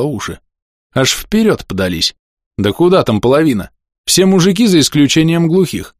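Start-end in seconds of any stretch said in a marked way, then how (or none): none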